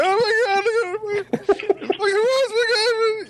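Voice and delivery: high-pitched squealing mock panic; mock terrified kids. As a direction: squeal like little kids scared witless, hammed up. Kids terrified at horror movie, retold.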